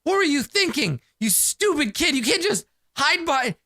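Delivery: high-pitched scolding without real volume; mock yelling. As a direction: act out telling off a reckless kid. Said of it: "so I'm just yelling at him".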